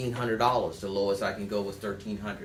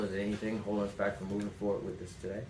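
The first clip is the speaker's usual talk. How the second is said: softer and fast, almost tossed off; soft quick close; 4 semitones lower, 7 dB quieter, faster than usual. Shown as a demonstration. Salesman closes the nurse softly and fast. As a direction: slip the closing question in quietly and quickly. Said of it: "You could say it with sincerity".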